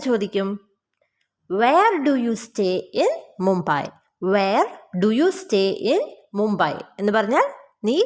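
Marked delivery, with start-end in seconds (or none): 1.5-6.7 slow, measured question for learners; slow model sentence. Shown as a demonstration. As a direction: say it slowly, phrase by phrase. Teacher models English question slowly.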